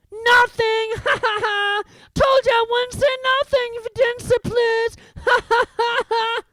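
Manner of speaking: very high, loud gleeful taunt; cackling poltergeist. A narrator's reading. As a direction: crow over him, laughing. Peeves mocking Filch.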